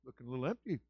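usual speaking voice

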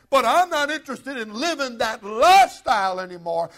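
loud, high, impassioned sermon; full-force preaching. Real, not acted. Preacher urging church over old lifestyle, full-force preaching. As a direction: preach it out, building to a shout.

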